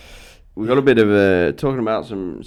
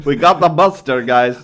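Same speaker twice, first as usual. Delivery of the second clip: loud, raised mock announcement; mock alarm call; 3 semitones higher, 8 dB louder than usual. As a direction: play along, calling out to the guys. Joins in mock calling out.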